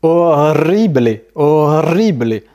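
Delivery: horrible drawn out as o-rri-blé; slow horrible with rolled R and final é; Spanish E as é. Shown as a demonstration. Teacher showing Spanish E, horrible ending in é. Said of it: "Le E va toujours se prononcer E".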